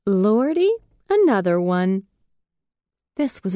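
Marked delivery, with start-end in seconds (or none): 0.1-2.1 higher, lighter, with mischief; a playful girl teasing the newcomer; bouncy and light. A narrator's reading. Lovey greets the new arrival, bouncy and mischievous. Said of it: "bouncy and light, with a hint of mischief".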